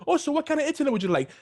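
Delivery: high, fast customer service voice; quick chirpy rep imitation; mock sales rep. Quoting the sales rep to his friend, high and fast. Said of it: "She said".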